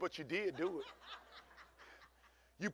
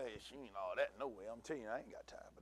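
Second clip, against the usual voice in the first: much softer and lower, under the breath; muttering; 10 semitones lower, 13 dB quieter, faster than usual. Shown as a demonstration. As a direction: mutter it like gossip behind her back. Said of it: "I'm talking underneath my breath".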